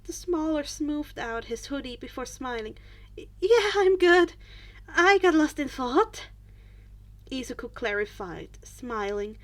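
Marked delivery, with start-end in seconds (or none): 3.2-6.3 flustered reassurance. A narrator's reading. Flustered boy reassures friend.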